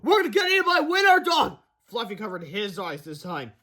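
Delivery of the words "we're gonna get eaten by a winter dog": very high-pitched wail; hammed-up terror; panicked cry. A narrator's reading. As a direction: yell as danger closes in. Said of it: "cried Cheeseball".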